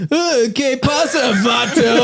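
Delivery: put-on high, slow parody Mexican accent; exaggerated Chicano slang voice; mock Mexican accent. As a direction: greet him like a tough inmate, hammed up. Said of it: "voicing inmate".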